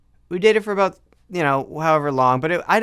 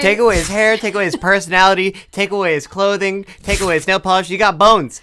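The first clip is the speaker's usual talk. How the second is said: loud, high-pitched mock tirade; hammed-up rant; 9 semitones higher, 11 dB louder, faster than usual. Co-host joining in to tear down the crush, hammed-up rant.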